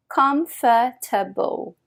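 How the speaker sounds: comfortable slowly, every syllable full, com-for-ta-ble; comfortable stretched out the French way; wrong French comfortable. Shown as a demonstration. Teacher showing what not to say, slow and full.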